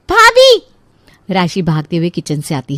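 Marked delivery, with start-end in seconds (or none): 0.1-0.6 very high, loud shout; shrieked, impatient summons; yelling out. Acted. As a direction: call sharply to someone in another room. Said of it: "इतनी जोर से क्यों चिल्ला रही हो".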